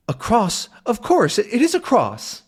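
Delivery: high, doddery reply; high-pitched professor, cheerful; old professor voice. Acted. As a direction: answer like a deaf old man. Calculus continues.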